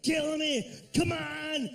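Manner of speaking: put-on high, shouted Austrian-accented taunt; Predator Arnold. Arnold covered in mud taunting the alien, shouting. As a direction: roar the challenge at the alien. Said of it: "He's like".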